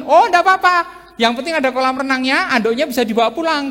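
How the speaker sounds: breezy, don't-care delight; cheerful sanguine guest. Acted. Sanguine guest happy with any hotel, loud and cheerful. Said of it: "Tapi kalau sanguin".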